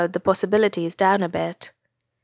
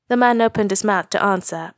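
plain reading voice

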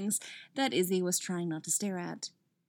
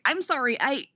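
flustered apology; 5 semitones higher, 4 dB louder than usual. Acted.

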